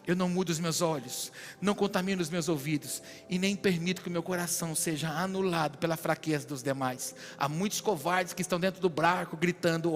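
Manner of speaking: ordinary talk, nothing marked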